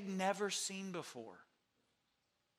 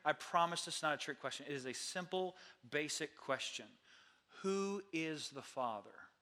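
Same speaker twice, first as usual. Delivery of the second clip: ordinary talk, nothing marked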